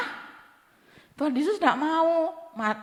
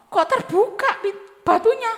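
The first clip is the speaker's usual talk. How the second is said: slow, rising higher in disbelief; surprised question; 8 semitones higher, 4 dB louder than usual. Acted. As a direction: sound puzzled at the open tomb. Women find the tomb open. (kaget dan heran, nada naik)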